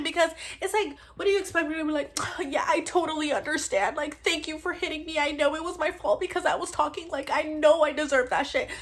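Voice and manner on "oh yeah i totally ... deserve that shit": high sarcastic fake gratitude; put-on high voice dripping with sarcasm; mock understanding. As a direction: pretend to be sweetly agreeable, pitched up. Sarcastic imagined forgiving reply.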